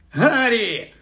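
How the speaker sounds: high, effusive call of the name; mock-delighted greeting. A narrator's reading. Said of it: "Фред підіпхнув Персі і уклонився".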